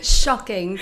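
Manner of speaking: loud mock-British echo; British accent. Host mimicking the guest's word, loud British accent.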